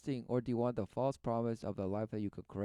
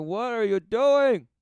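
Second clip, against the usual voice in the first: high, loud, slow cry of alarm; the spirit calling out; 12 semitones higher, 14 dB louder, slower than usual. Acted. Commentator voicing the inner spirit crying out, high and loud. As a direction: call out like a startled inner spirit. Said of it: "the spirit saying".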